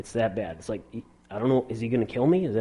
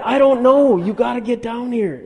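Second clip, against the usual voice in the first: high, loud, frantic retold plea; hammed-up panic at top pitch; mock panicked yell; 12 semitones higher, 8 dB louder than usual. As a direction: act out yelling for dad to come.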